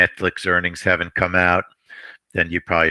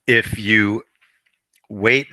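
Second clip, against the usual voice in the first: choking; 3 semitones higher, 4 dB louder, slower than usual. Real, not acted. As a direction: voice catching on a throat tickle. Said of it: "Hold on, I'm choking".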